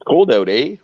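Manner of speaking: mock Canadian small talk, ending as a question; put-on Canadian with a lifted "eh"; lightly joking Canadian accent. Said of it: "everything's a fucking question with that".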